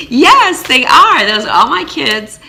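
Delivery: very high, loud, proud yes; still really happy. Retold or imitated. Mother telling the neighbor all the kids are hers, happily. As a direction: chirp it out proudly, beaming. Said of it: "And I was still really happy".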